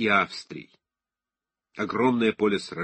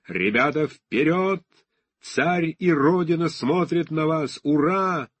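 rousing battle cry to the troops, loud and slow; roaring rallying speech; 6 semitones higher, 4 dB louder, slower than usual. Acted. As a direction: yell the charge, lifting the men forward. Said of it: "earlier reader: roaring rallying speech".

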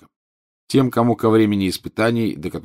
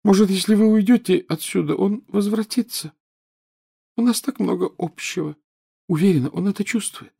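put-on high, hopeful ghost girl voice; a lovesick ghost girl pining; dreamy ghost girl; 12 semitones higher than usual. Myrtle voiced by narrator.

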